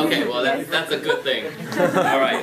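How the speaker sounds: words bubbling through real laughter; laughing while talking. Real, not acted. Answering the shy guest, laughing along. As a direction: chuckle through the reply.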